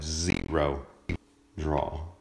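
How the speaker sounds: "zero" drawn out slowly; "zero" split off and lengthened; emphatic. Real, not acted. Stressing the lack of drawl.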